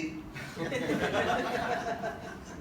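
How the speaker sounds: breathy high laugh at the memory; soft giggling laugh; laughter. Real, not acted. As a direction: laugh it out, delighted. Laughing at own story.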